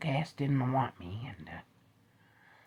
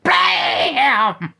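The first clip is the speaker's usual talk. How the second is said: shouted gunshot; 12 semitones higher, 16 dB louder than usual. Acted. Gunshot sound effect.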